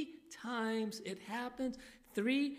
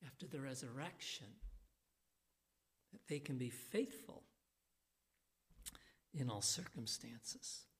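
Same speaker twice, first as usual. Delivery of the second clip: whisper; 6 semitones lower, 9 dB quieter than usual. Real, not acted. Sermon point whispered.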